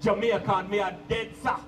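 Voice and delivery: Jamaican accent, lightly voiced proud youth; a touch of Jamaican patriotism; proud young Jamaican. Young Jamaicans boast of home, light Jamaican accent. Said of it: "the young Jamaicans be like".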